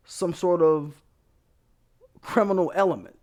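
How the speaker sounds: ordinary talk, nothing marked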